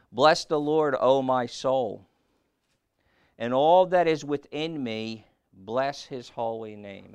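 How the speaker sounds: loud, slow, solemn reading of the psalm; scripture reading. Acted. Preacher to his congregation, loud slow scripture proclamation. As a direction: proclaim the verse slowly and loudly. Reads Psalm aloud in sermon.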